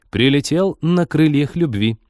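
slow, high, grandiose announcement; high-flown. A narrator's reading. Flirty virtual cupid announces the pilot's return, high-flown. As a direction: declaim it pompously, pitch lifted and pace stretched. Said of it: "высокопарно сообщила Маша".

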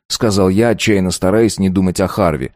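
ordinary talk, nothing marked

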